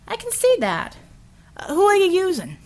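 high startled builder voice; surprised builder voice. A narrator's reading. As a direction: caught off guard. Hensel voiced in reading.

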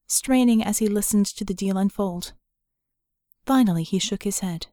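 plain reading voice

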